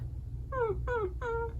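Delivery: high soft whine of a sad puppy; mock puppy cry, high and soft; sad dog whimper. Shown as a demonstration. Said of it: "sound does a dog make if it's sad".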